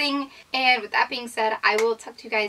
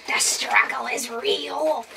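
whisper; slower than usual. Real, not acted. Joking whispered aside.

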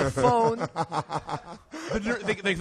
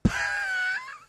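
dying gasp. Retold or imitated. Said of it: "then he just goes".

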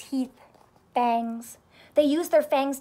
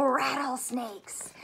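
ordinary delivery

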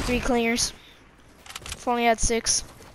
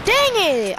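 sharp, higher annoyed yell; frustrated outburst; 8 semitones higher, 5 dB louder than usual. Real, not acted. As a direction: blurt it out in frustration. Missed shot, frustrated outburst.

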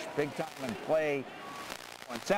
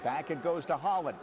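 ordinary talk, nothing marked

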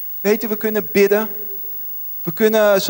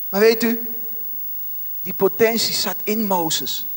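normal talking voice

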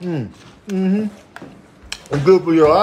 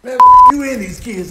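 exasperated yell; 10 semitones higher, 9 dB louder than usual. Acted. Exasperated outburst.

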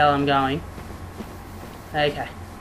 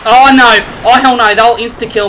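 loud, raised cry of dismay; alarmed shout; 5 semitones higher, 10 dB louder than usual. Real, not acted. Gamer reacting to an attack that will insta-kill, alarmed shout. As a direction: yell it out in alarm.

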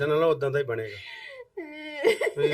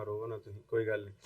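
soft, lower consoling voice; soothing; 4 semitones lower, 13 dB quieter than usual. Real, not acted. Visitor telling weeping mother not to cry, soothing. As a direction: hush someone gently, much softer.

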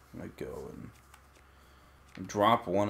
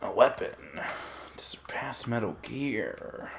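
snake voice. Retold or imitated. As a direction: rasp it out like Solid Snake. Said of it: "Joey's snake voice is much better than mine".

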